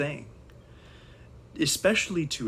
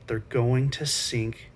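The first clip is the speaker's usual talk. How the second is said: ordinary delivery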